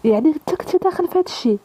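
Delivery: shocked. A narrator's reading. Reem learning of his stolen-car past, shocked. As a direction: raise the pitch in understated disbelief. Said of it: "ريم توسعوا بالصدمة".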